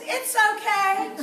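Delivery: high, loud, drawn-out reassurance; upbeat. Retold or imitated. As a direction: imitate mom cheerfully brushing it off. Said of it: "she was so upbeat".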